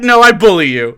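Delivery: ordinary talk, nothing marked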